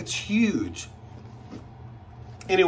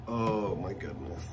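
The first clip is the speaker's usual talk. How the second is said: hushed awe; 8 semitones lower, 9 dB quieter than usual. Real, not acted. Admiring bag lining.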